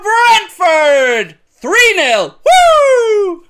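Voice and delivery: high, loud goal call; goal shout. Real, not acted. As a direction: roar the goal like a fan. Commentator celebrating goal.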